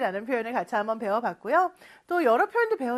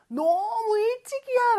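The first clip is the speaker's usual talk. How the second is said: surprised early; 11 semitones higher, slower than usual. Acted. Acting surprised parent morning.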